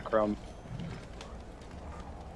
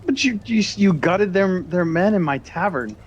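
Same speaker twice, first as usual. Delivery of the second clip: higher-pitched, stammering objection; upset protest; 7 semitones higher, 3 dB louder than usual. Acted. Barkeep confronts the party about gutted men, upset. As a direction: protest in distressed disbelief.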